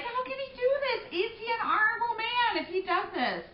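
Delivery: high, loud indignant questions; indignant outrage. Real, not acted. Teacher venting anger at the friar, high and loud. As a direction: demand an answer, outraged. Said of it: "get so mad at the friar".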